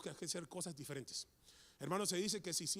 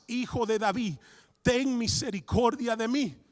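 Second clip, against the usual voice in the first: loud slow plea; shouting; 3 semitones higher, 11 dB louder, slower than usual. Acted. Bartimeo shouting over the crowd, loud slow plea. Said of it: "él gritaba mucho más".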